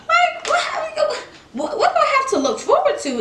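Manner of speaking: high, wailing mock despair. Vlogger acting out despair over her husband, high mock wail. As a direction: wail it up high like a hammed-up heartbreak.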